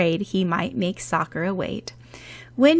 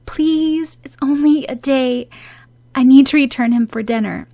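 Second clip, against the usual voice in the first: pleading; 6 semitones higher, 7 dB louder than usual. Acted. Pleading quickly.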